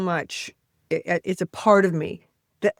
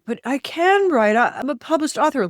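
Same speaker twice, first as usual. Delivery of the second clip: higher, protesting voice; insist, pitched up, that you can write; 8 semitones higher, 3 dB louder than usual. Retold or imitated. Talks back to the inner critic in a higher, protesting voice. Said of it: "I'll go like".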